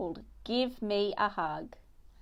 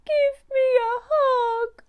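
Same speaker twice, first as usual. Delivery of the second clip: high, loud, tearful wail; crying little mouse; 6 dB louder than usual. Acted. Frightened mouse Missy crying to her mum for a hug. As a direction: sob it out like a scared little mouse. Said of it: "wailed Missy".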